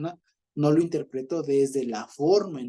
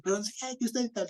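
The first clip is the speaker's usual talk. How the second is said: mock angry driver; 9 semitones higher than usual. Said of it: "también está fosforito".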